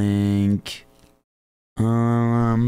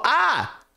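test yell. Shown as a demonstration. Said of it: "Can I yell though?".

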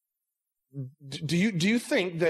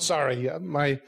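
ordinary talk, nothing marked

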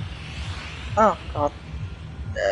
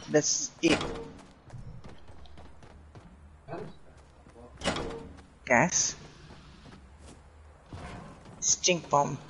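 soft, low words with long waits between; real hushed tension while lying in wait; hushed stealth; 4 semitones lower, 13 dB quieter than usual. Real, not acted. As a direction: keep low and quiet near the enemy. Lying in wait near enemies.

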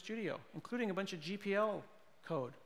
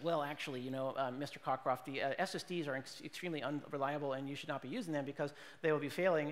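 low, hurried nerdy pedant; nerd voice; 3 semitones lower, 4 dB quieter, faster than usual. Retold or imitated. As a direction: correct the speaker like a pedantic nerd. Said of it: "but I can do nerd".

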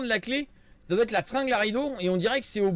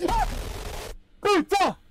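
startled scream; 9 semitones higher, much slower than usual. Real, not acted. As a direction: jump out of your skin. Jump scare in horror game.

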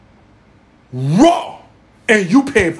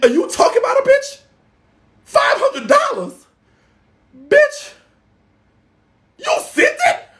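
much higher, slow stunned squeal; incredulous shriek. Real, not acted. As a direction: disbelief pitched way up. Incredulous squeals at five hundred.

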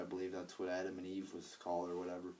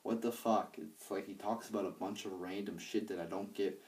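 ordinary talk

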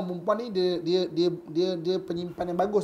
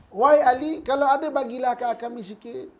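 louder, high-pitched begging call; raised-voice appeal for a share; pleading widow; 5 semitones higher, 5 dB louder than usual. Acted. Widow's plea acted out once more.

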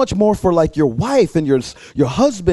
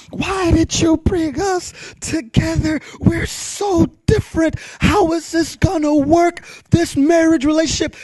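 high-pitched, pleading complaint; worked-up mock rant, pitch way up; venting to God; 12 semitones higher than usual. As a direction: wail it out in exasperation, acted. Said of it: "I'm venting to the Lord".

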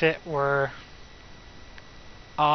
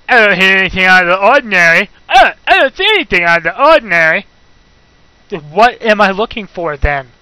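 fast, loud, frustrated outburst; exasperated rant; 10 dB louder, faster than usual. Real, not acted. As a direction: blurt it out loud and fast, at wits' end. Stuck in game, ranting.

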